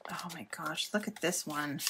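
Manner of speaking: breathless whisper of wonder; soft whispered oh my gosh; awed whisper. Real, not acted. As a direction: hush it like a secret treasure. Opening a handmade journal gift.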